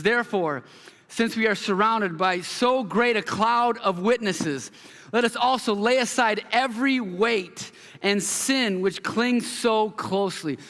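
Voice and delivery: slow, measured reading of the verse; scripture reading. Acted. Pastor reading Hebrews twelve to the church, slow and reverent.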